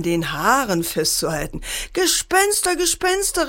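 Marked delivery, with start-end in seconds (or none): 1.9-3.5 put-on high-pitched children's cry of alarm; storyteller voicing two scared children; alarmed calling out. A narrator's reading. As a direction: call it out high and urgent, not full volume. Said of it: "riefen sie".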